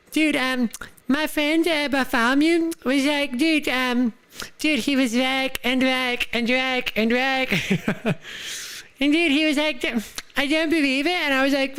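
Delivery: high, silly put-on voice telling a story about his friend; goofy high character voice. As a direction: spin the yarn in a squeaky dude voice. Tells Bartholomew story in voice.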